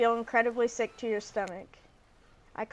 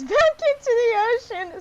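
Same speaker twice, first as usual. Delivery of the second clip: giggling through the words, higher and louder; can't hold back the laughter while reading; laughing; 11 semitones higher, 8 dB louder than usual. Real, not acted. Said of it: "I can't stop laughing".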